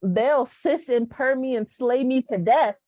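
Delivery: usual speaking voice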